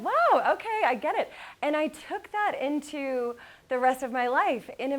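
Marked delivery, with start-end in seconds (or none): 0.0-1.3 high, loud amazed wow; sudden dawning awe; amazed realization. Retold or imitated. Daughter finally grasping her mom's mantra, high and amazed.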